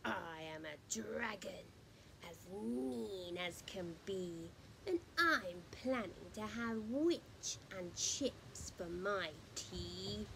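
low, hushed dragon threat; a dragon whispering menace; sinister hushed dragon; menacing dragon whisper. Acted. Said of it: "heard a roar".

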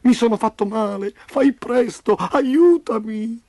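high, plaintive whine, slowing at the end; moaning. A narrator's reading. Injured Roby begging Memo for help, moaning. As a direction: plead in pain, stretching the last word. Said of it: "gemette Roby".

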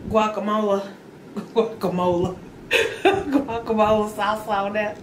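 giggling through the word; laughing while talking. Real, not acted. Laughing about guacamole.

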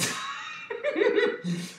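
shrieking high laugh; high giggle. Real, not acted. Beer foaming over.

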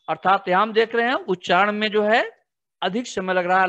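ordinary talk, nothing marked

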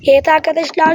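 ordinary delivery

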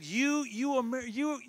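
high-pitched retold accusation; insulting accuser. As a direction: imitate an angry man hurling insults, pitched high. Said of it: "He started insulting America".

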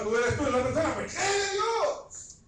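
rising into a slow, high cry; tearful plea. Real, not acted. Preacher begging the woman to believe, crying. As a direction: plead through tears, breaking into a shout.

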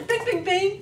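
noisy clanging imitation; pot lid banging. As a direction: bang it out like pot lids. Banging pot lids at raccoon.

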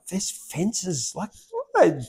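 high, puzzled bird voice. Pretend. A bird wondering at human fences, high and puzzled. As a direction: squeak it out like a baffled bird. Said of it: "If you're a bird, it's like".

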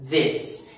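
"this" with a short i, as in miss; short vowel demo. Shown as a demonstration. Teacher contrasting this and these, short-vowel demo on "this". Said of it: "this es como miss".

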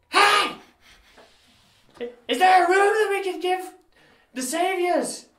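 high, loud call through the inn. Acted. Innkeeper calling out for staff.

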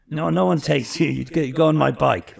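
retold order in a put-on German accent; imitated German accent. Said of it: "I'm not gonna do the accent".

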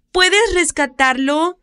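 put-on slow, high, nervous little girl; nervous child. Little girl begs for the cat's rescue, slow and nervous. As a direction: ask it anxiously, like a worried child. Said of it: "preguntó Mandy con nerviosismo".

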